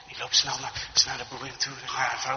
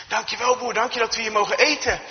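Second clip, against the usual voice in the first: raised, cheerful grateful voice; enthusiastic thanks; 7 semitones higher, 5 dB louder than usual. Acted. Arriving dinner guests thanking the farmer, enthusiastic. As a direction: thank the host with lifted pitch. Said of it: "die kijken enthousiast en zeggen".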